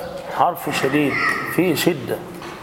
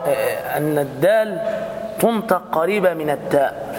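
ordinary delivery

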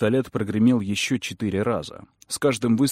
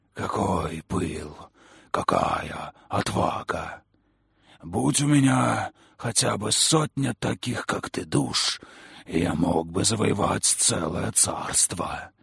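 mocking creature voice; slower than usual. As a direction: savour each word like a predator. Creature voice admiring.

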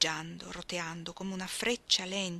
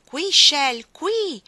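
crying out to him; 8 semitones higher, 13 dB louder, much slower than usual. Acted. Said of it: "gridò".

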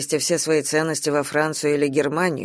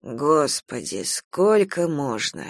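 slow, drawn-out weary groan of words; weary exasperation; 4 semitones lower, 4 dB quieter, much slower than usual. Acted. Uncle fed up with nephew's threats, slowly exasperated. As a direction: drag it out, fed up.

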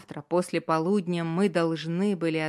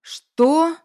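shrill, much higher, loud; shocked shout; 11 semitones higher, 12 dB louder than usual. Acted. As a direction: shout it in disbelief. Friend hears of betrayal.

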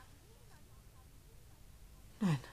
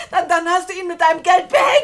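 louder, a furious shouted accusation; 4 dB louder than usual. Acted. Julia screams that Edna bought Frank with money. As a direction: hurl the accusation at her.

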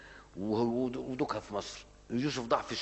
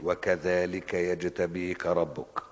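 drawn-out chanted recitation, very slow; measured Quranic chant; much slower than usual. Shown as a demonstration. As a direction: intone the verse slowly like tajweed. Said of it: "ده أنت أكدت وقلت".

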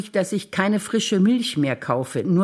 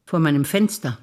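ordinary delivery

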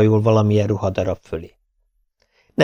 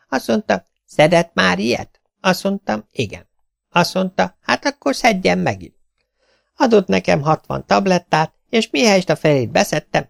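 lightly voiced tailor, higher, moaning about work; complaining tailor; 6 semitones higher than usual. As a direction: sigh over the endless work. Tailor tells doctor story.

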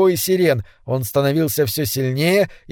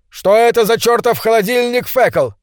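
higher, louder shout over the noise; 7 semitones higher, 4 dB louder than usual. A narrator's reading. Husband yells over the loudspeaker roar to his wife. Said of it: "крикнул".

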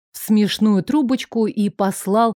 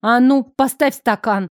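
threatening bark; 3 semitones higher than usual. A narrator's reading. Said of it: "она гаркнула с такой угрозой".

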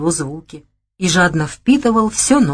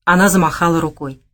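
ordinary delivery

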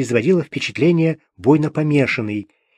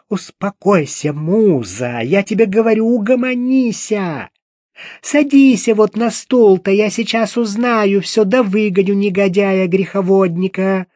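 put-on higher, bustling voice of an old woman calming a friend; second woman's voice; 5 semitones higher than usual. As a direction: soothe and scold at once, pitched up. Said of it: "раздался другой голос, тоже женский". (высоким голосом старушка утихомиривает Музу)

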